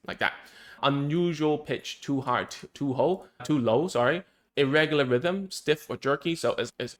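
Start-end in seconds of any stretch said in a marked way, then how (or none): none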